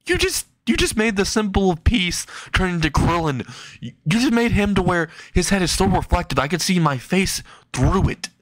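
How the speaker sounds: high-pitched incredulous rant; mock-appalled scolding; acted outrage. Izuku scolds Kurumi in acted outrage.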